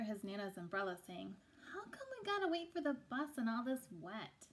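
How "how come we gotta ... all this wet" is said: put-on higher, a kid whining to his grandma; a small boy complaining; light child whine; child's voice.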